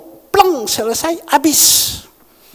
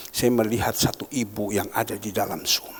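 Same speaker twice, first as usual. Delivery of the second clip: whispered aside; 9 semitones lower, 6 dB quieter than usual. Real, not acted.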